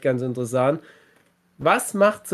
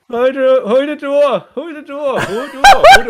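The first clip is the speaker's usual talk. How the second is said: very high, loud yowling chant; kitten voice; 12 semitones higher, 9 dB louder, slower than usual. Acted. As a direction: yowl like a cat, rising. Cat chant, Game of Thrones joke.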